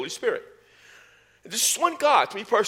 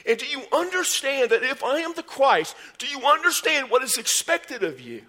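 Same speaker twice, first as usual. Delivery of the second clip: impassioned preaching; 8 semitones higher than usual. Acted. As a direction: drive the point home at full force. Preacher builds to a peak.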